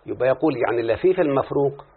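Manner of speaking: ordinary talk, nothing marked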